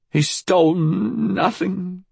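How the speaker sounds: put-on high old woman, faltering; slow, high, flustered denial; stuttered. A narrator's reading. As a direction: drag the words out as you falter. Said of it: "she stuttered".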